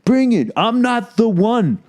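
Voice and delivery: high, slow tough-guy taunt; gym bro. Pretend. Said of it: "You wanna do this".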